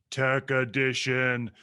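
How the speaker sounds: slow, drawn-out effects voice; booming announcer voice. Shown as a demonstration. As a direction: stretch it out like an echoing show title. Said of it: "do my low booming voice".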